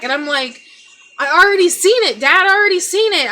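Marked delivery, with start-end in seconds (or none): 1.2-3.3 high, loud and rushed retold protest; panicked reply. Daughter to stepdad beside the body: panicked fast protest. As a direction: blurt it out fast in distress.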